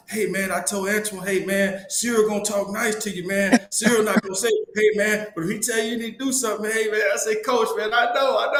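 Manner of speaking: lively mock-pleading with man-to-man urgency; imitating Coach. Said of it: "Coach is like".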